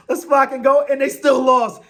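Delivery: excited cheer. Real, not acted. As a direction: shout it like you just won money. Cheering his bet.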